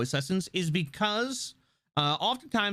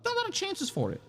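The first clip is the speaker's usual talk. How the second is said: high voice; 3 dB quieter, faster than usual. Pretend. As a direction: jump way up high. Repeats phrase in high voice.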